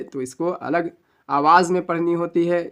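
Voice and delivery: usual speaking voice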